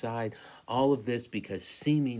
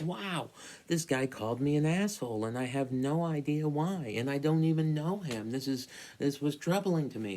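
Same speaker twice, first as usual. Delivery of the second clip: shocked disbelief; 6 semitones higher than usual. Retold or imitated. Retelling his shocked reaction.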